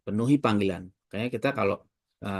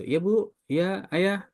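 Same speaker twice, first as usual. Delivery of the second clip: polite reply; 11 semitones higher, much slower than usual. Retold or imitated. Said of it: "bahasa kita gitu".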